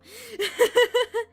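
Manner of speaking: high loud laughing burst; loud giggle. Real, not acted. Laughs at impression request.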